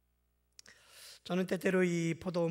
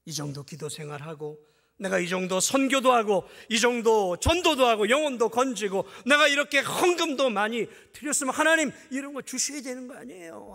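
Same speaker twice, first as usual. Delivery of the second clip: bargaining believer; 7 semitones higher, 11 dB louder than usual. Retold or imitated.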